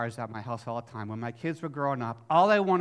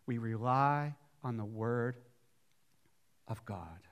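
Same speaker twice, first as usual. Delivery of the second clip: hushed; 5 semitones lower, 4 dB quieter than usual. Real, not acted. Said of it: "Listen to me".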